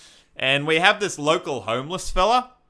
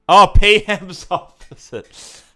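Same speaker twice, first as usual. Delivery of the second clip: laughing; 7 semitones higher, 8 dB louder, slower than usual. Real, not acted.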